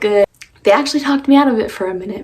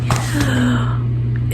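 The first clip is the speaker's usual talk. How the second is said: shocked gasp; 3 semitones higher than usual. Real, not acted. Sees dyed hair.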